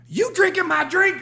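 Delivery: high, loud, drawn-out challenge; mock confrontational shout. Woman confronting the man who took her drink, mock shout. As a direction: imitate an angry drinker yelling in someone's face. Retold confrontation, right in his face.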